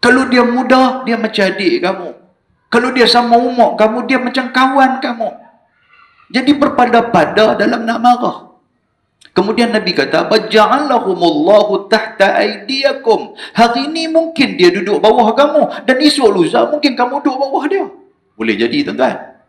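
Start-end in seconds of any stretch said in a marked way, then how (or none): 0.0-10.2 higher, forceful sermon voice; fiery preaching. Acted. 10.2-13.4 slow, measured Arabic recitation; hadith recitation. Acted. 13.5-17.8 higher, forceful sermon voice; fiery preaching. Acted.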